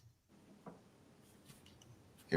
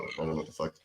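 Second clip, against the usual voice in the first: ordinary delivery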